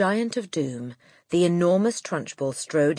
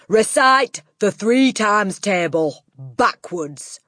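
slow, loud barked order; Trunchbull; 6 semitones higher, 7 dB louder, slower than usual. A narrator's reading. Headmistress making a boy recite backwards, drawn-out bark. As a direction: bark it out word by word, higher. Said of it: "the Trunchbull barked".

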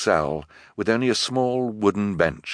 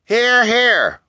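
very high, loud cheer; shrill-pitched shout of assent; crowd cry of approval; 7 dB louder than usual. A narrator's reading. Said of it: "cried several voices scattered in the crowd".

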